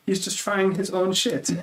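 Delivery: hammed-up German accent, drawn-out punchline; bad German accent. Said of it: "I'm gonna butcher his accent right now".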